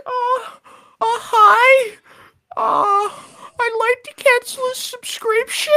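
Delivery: put-on high, timid squeaky kid voice; nervous kid voice. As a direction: squeak it out like a scared teen.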